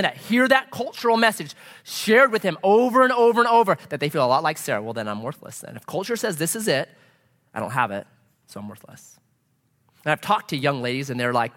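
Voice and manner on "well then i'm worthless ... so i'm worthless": lightly voiced dejection, low and soft; dejected. Preacher voicing women who feel worthless, understated and dejected. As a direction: sink quietly into defeat.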